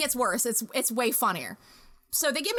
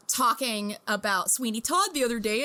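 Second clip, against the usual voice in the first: ordinary delivery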